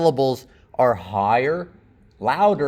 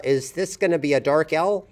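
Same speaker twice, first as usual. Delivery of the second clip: normal talking voice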